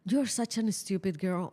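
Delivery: usual speaking voice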